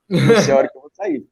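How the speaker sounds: amused, laughing retelling of a quip. Retold or imitated. Telling the driver he may not come out of the pyramid, laughing.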